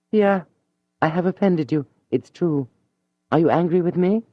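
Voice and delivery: a proud lady suddenly meek; humble young woman. Acted. Mathilde to Julien, pale and humbly pleading.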